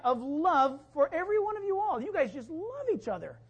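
high, loud, fired-up preaching; preaching at full force. Acted. Pastor rouses the congregation with a loud, high-pitched sermon. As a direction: lift the church with a ringing sermon climax.

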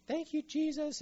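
high-pitched, imitated tearful thanks; mock weeping gratitude. People walking out of church, mock-weepy thanks.

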